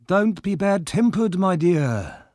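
put-on high, soothing old-man voice; smooth him over, pitched high.

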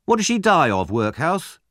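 high, prying bully's question; needling question, lifted in pitch; goading taunt. Acted. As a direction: goad him towards tears. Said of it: "hoped that Oliver was going to cry".

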